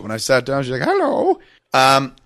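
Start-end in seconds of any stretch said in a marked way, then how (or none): 0.8-1.4 put-on high-pitched old lady greeting; sweet little high hello. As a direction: chirp it like a tiny old lady. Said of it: "little old lady and shit".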